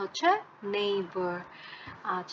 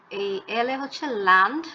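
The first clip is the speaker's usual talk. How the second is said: ordinary talk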